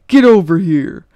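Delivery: put-on man shouting at the frozen child; menacing shout. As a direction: roar it as he stomps closer. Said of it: "the man shouts once more".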